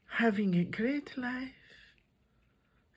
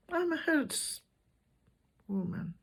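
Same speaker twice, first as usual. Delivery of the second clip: usual speaking voice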